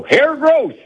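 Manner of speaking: high, hammy infomercial announcer; infomercial pitch. Retold or imitated. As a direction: sell it like a cheesy TV ad. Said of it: "sounds like a infomercial".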